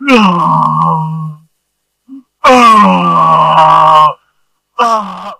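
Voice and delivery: loud drawn-out wailing cries; imitated wailing. Imitating the fallen man, loud wailing cries. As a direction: wail like a man on the floor. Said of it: "and he is wailing".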